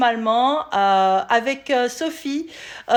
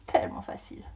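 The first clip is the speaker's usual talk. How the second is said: whispering; 8 dB quieter, faster than usual. Real, not acted. Quiet aside after squeezing lemon.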